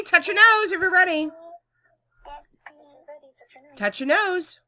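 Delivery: bright, loud classroom voice; kindergarten teacher voice. Acted. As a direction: lead little kids cheerfully. Teacher leads class routine.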